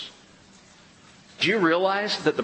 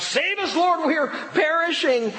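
much higher, shouted plea for rescue; panicked cry. Retold or imitated. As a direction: cry out like drowning men in a storm. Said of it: "The apostles cried out".

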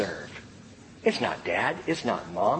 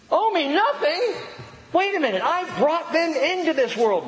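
indignant parent; 13 semitones higher than usual. Retold or imitated. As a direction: huff like an outraged parent.

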